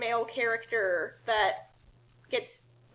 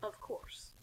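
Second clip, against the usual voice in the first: whispered of course; quiet; 4 semitones lower, 17 dB quieter than usual. Acted. Discord answering Kasumi in a whisper. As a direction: breathe it out airily.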